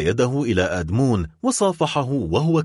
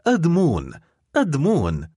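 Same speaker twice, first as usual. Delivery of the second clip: higher-pitched, contained eager calling; eager cry; 5 semitones higher than usual. A narrator's reading. Girl opens the door to her beloved, eager cry. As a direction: call the beloved's name with longing. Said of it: "وهي تصيح في لهفة".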